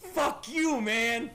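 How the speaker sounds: high startled yell; angry shout. Real, not acted. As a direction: yell it out in fright. Startled outburst at mannequins.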